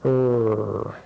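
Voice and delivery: "er" held long with rounded lips; puckered er sound. Shown as a demonstration. Teacher shows how to make that sound, puckered "er". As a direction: pucker a little and hold "er". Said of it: "pucker your lips a little bit".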